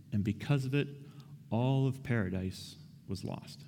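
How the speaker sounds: normal talking voice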